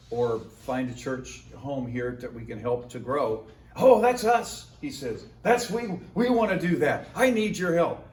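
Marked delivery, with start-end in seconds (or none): 3.8-4.7 higher, louder delighted burst; eager pastor. Retold or imitated. 5.4-8.1 higher, louder delighted burst; eager pastor. Retold or imitated.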